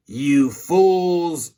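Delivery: a mock villain's scream in a strange voice; hammed-up alien yell. A narrator's reading. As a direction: snarl it like a space baddie. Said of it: "screamed Zork".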